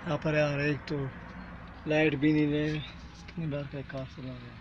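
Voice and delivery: low, very quiet, wary murmur; tense hushed exploring voice. Real, not acted. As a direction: keep your voice right down in the dark. Creeping into a dark, feared place.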